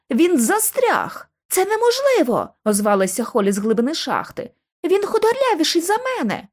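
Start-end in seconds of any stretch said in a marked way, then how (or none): 0.1-1.3 high, drawn-out exclamation; alarmed cry. A narrator's reading. Lucy finds Bobby stuck in the shaft, crying out. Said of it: "несподівано скрикнула я". 1.5-2.5 high-pitched disbelief; indignant protest. A narrator's reading. Holly protests from the shaft. 4.8-6.5 high-pitched incredulous objection; indignant protest. A narrator's reading. Holly answers from the shaft, indignant.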